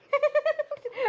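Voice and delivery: high loud laugh bursting out; big burst of laughter. Real, not acted. Crafter laughing at her card going wrong. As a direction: crack up at your own mess.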